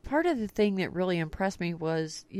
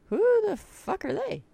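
baffled; 13 semitones higher, 4 dB louder than usual. Retold or imitated. Inner reaction at Vape Bash.

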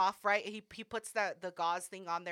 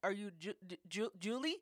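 stuttering; 8 dB quieter than usual. Retold or imitated. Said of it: "I'll do a brief thing... stutter".

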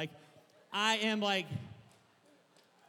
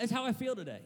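usual speaking voice

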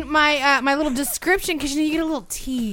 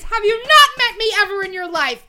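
loud; 10 semitones higher, 6 dB louder than usual. Real, not acted. Playful indignant outburst.

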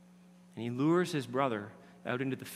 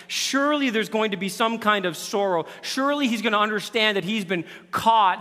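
louder preaching, slowing at the end; forceful preaching; 6 semitones higher, 7 dB louder than usual. Acted. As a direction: drive it home from the pulpit.

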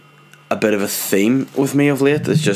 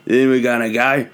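put-on tour guide's London accent drifting into Australian; hammed-up, wandering London-Australian tour patter; botched London accent turning Australian; 2 semitones higher than usual. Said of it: "what happened to the accent there".

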